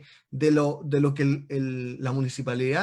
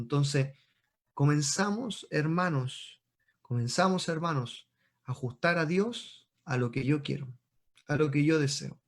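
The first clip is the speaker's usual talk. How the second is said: ordinary talk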